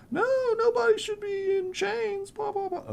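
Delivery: very high falsetto whine; mock them in a squeaky falsetto; mocking protesters. Game master parodies abolitionists' protest, high mocking falsetto.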